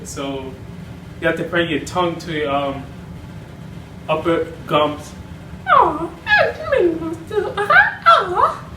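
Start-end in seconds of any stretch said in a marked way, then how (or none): 5.7-8.7 very high cooing giggly mouse; Minnie Mouse voice. Shown as a demonstration. Tutor demonstrating Minnie's voice. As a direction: coo it sweetly up high. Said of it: "Minnie Mouse is hard too".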